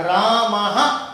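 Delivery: रामः drawn out, ending in an audible "ha"; visarga as ha. Shown as a demonstration. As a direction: sound the ending of रामः as a clear breathed "ha". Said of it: "इसका उच्चारण होगा है की तरह".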